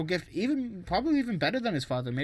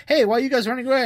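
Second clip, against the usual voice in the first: raised, louder acted call; 8 semitones higher, 5 dB louder, much faster than usual. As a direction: call after people running off. Acted calling after fleeing students.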